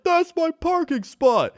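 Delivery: high, loud, slow put-on voice; imitating balcony guy. Retelling a neighbor yelling from his balcony, high indignant voice. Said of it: "he's like".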